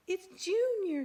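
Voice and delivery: high, loud joyful cry; very excited. Acted. Mister Bird seeing the baby hatch, loud and overjoyed. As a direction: burst out with delight. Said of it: "Mister Bird was very excited".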